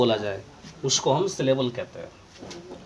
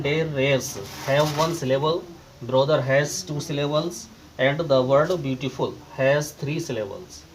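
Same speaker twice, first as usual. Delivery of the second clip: normal talking voice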